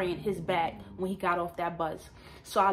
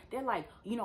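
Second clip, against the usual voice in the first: normal talking voice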